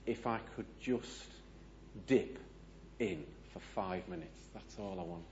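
soft, halting inner thought; hushed, retold yearning to oneself; wistful longing. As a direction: voice a quiet craving, trailing away. Retold longing thought beside pool.

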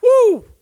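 very high, very loud whoop of joy; joyful whoop. Real, not acted. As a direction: let out an exultant cry. Preacher exults over faith.

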